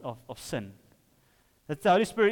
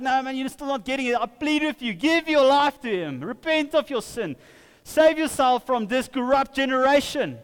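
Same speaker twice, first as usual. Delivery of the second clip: pleading; 6 semitones higher, 3 dB louder than usual. Retold or imitated. Peter pleading with the crowd.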